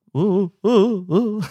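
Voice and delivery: ordinary delivery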